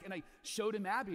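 normal talking voice

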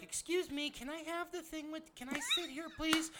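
small, very high, soft mock-polite voice; timid polite rider. Polite rider asking for a subway seat, mock timid voice. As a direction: ask meekly like you'd never dare. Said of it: "you're like".

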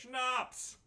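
high, laughing whoop at a friend's grimace; gleeful cry. Real, not acted. Watching his friend down a schnapps shot, a gleeful whoop. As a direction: cheer him on as the shot burns.

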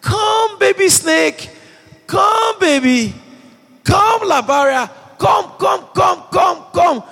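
very high, sing-song calling voice, shouted in mock sweetness; coaxing a snake. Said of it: "Nobody sees a snake and says".

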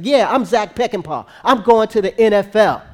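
playful imitation of a boast; mock boasting. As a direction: imitate a cocky young player announcing himself. Player boasting to his father.

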